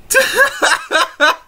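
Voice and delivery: high breathless giggle; high helpless laugh. Real, not acted. Vlogger losing it over a meme, high helpless laugh. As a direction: let the laugh squeak out.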